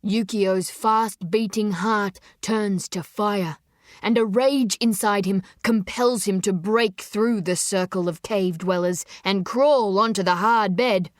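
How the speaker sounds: usual speaking voice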